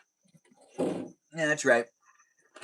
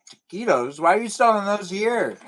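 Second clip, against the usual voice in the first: mock-incredulous, pitched high on the question; pretend puzzled customer; 7 semitones higher, 3 dB louder than usual. Imagined Starbucks customer questions the menu, high mock disbelief. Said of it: "Or would you go".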